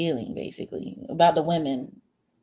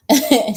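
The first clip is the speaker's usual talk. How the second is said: short laugh; 6 semitones higher, 9 dB louder than usual. Real, not acted. Laughs at her own answer.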